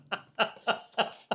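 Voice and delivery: a breathy, unvoiced burst of real laughter. Real, not acted. Detective laughs at his own story's punchline, breathy laugh. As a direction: laugh at how the story ended.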